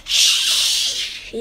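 long unvoiced hush; whispered shh. A narrator's reading. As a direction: hush them with a drawn-out shh. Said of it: "Zoe whispers".

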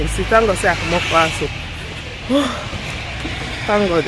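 ordinary delivery

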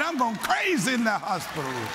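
ordinary delivery

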